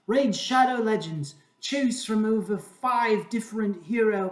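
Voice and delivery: hammed-up British accent that's going to seed; shaky British accent. Host spoofs a YouTube sponsor read for Raid Shadow Legends, failing British accent. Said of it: "British accent is, uh, going to seed".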